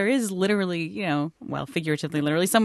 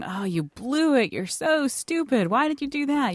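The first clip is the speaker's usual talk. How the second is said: mock bully self-talk; 6 semitones higher than usual. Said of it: "being a bully with yourself".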